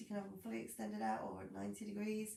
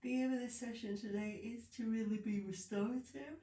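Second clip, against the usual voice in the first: talking through a yawn; 2 semitones higher than usual. Real, not acted. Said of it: "Sorry, I can't stop myself".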